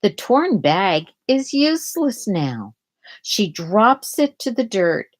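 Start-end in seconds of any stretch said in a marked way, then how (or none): none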